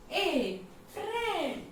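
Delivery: "ए" and "फ्रेंड" stretched slowly, pitched high; sound demonstration. Shown as a demonstration. Teacher isolating the vowel for students, high drawn-out demonstration. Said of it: "इसका pronunciation क्या हो रहा है".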